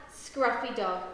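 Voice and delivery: ordinary delivery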